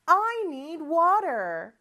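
slow English with long vowels; slow repeat drill. Shown as a demonstration. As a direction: lead learners through it slowly. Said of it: "한 번 더".

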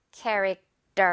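캐릭터 ending in a tense, unaspirated 떨; 캐릭터 in English, T softened to a tight 떠; tensed T. Shown as a demonstration. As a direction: say the 터 of 캐릭터 like 떠, without air. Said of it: "마치 떠처럼 발음이 되면요".

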